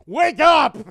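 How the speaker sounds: loud, high wake-up yell. Retold or imitated. Kid yelling to wake his brother, loud. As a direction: bark him awake from sleep. Waking someone to watch TV.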